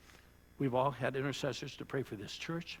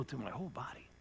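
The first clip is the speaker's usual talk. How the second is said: awed whisper; 8 dB quieter than usual. Real, not acted. Awed retelling of healing.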